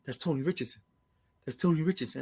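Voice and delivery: low, hushed imitation of a stunned brother; retold stunned disbelief. Serious brother awed at meeting Tony Richardson, stunned. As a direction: say it softly, as if your jaw dropped. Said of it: "my brother's jaw dropped".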